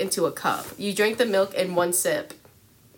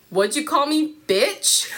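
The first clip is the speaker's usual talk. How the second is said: mock bully threat; 7 semitones higher, 4 dB louder than usual. Bully Mike threatening the girl.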